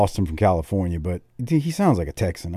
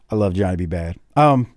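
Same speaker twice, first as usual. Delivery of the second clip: ordinary delivery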